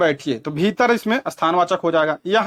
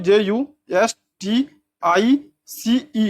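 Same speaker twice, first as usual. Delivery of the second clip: slow, letter by letter; measured spelling, each letter set apart; 3 semitones higher, much slower than usual. Shown as a demonstration. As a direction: spell it out with gaps between letters. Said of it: "इसकी spelling होती है".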